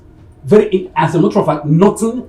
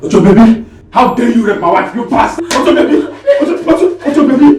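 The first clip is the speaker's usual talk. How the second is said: furious accusation; 8 semitones higher, 4 dB louder than usual. Acted.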